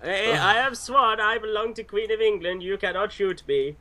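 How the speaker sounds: hammed-up Eastern European swan; Eastern European accent. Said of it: "acted out by all Eastern European pickup artists".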